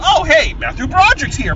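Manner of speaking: mocking the reveal with put-on surprise; mock delighted surprise. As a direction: play up the fake surprise as if pleasantly shocked. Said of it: "you're obviously supposed to go".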